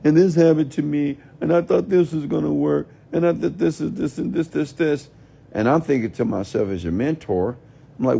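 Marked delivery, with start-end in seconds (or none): 0.0-5.1 mock whining. Mentor mocking viewers who whine about their lives, hammed-up whine. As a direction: whine it like a sulky child. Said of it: "when you whine".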